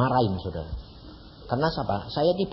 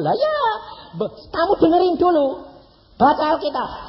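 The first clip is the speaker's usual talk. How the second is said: scolding; 13 semitones higher than usual. Retold or imitated. Preacher scolding the caller.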